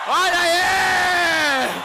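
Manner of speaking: high, very loud stretched shout; triumphant shout. Acted. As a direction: burst onto the stage.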